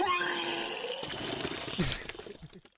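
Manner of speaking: a high cry of a laugh; high shrieking laugh. Real, not acted. Disgust at the apartment.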